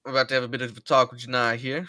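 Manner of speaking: loud fast order to the lads; loud commanding. Acted. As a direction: clear the room with authority. Boss ordering the crew.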